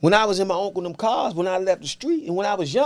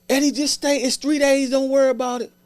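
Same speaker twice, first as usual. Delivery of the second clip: a higher, lightly voiced mother calming her son; mother's voice; 10 semitones higher than usual. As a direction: soothe him like his mother on speaker. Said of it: "My mother on the phone".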